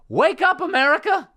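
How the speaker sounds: high, loud rallying yell; shouted catchphrase. Acted. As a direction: burst into a mock-outraged battle cry. Rant catchphrase returns.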